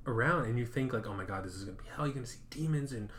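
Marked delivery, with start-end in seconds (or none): 1.1-3.1 fast, tumbling inner panic; fast, half-joking worried thoughts; rushed panic. Pretend. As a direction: rattle it off in a mock panic.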